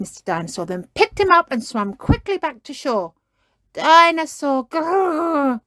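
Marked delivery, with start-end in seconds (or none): 3.7-5.6 high, loud playful growl; happy growl. A narrator's reading. George holds his dinosaur, playful growl. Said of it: "growled George happily".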